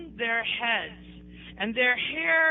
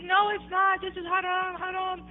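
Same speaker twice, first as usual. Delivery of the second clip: retold angry protest; 7 semitones higher than usual. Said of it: "I was so mad".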